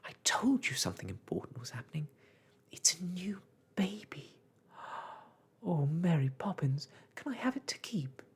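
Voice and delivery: high, thrilled outburst; excited cry. A narrator's reading. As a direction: gush eagerly over a wonderful discovery. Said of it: "Jane cried excitedly".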